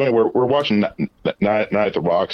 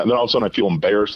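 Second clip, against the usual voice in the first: ordinary delivery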